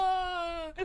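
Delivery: high drawn-out "ah"; high-pitched mock scream; mock yell. Voicing reaction in retold skit.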